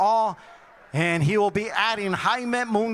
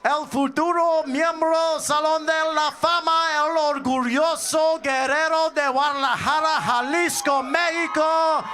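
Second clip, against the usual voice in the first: booming fight-night ring announcer; 5 semitones higher than usual. Acted. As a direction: hype up the crowd, building. Boxing ring introduction.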